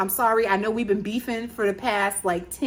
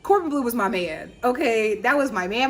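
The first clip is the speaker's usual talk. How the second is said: normal talking voice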